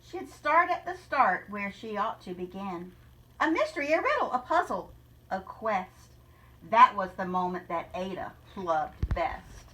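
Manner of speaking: rhythmic storybook read-aloud; story narration. Acted. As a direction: read the rhyme to the class with a lilt. Teacher reads picture book aloud.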